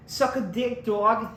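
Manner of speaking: put-on high, breezy New York accent. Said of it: "I had, like, a New York accent".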